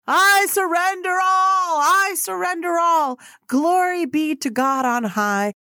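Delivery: loud, high, slow crying out, like a hymn; crying out surrender. Retold or imitated. Oprah walking the fat camp track, letting go of the role, loud high slow cry. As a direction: throw it up to the heavens, drawn out and loud. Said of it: "she was like".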